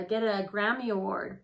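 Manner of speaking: ordinary talk, nothing marked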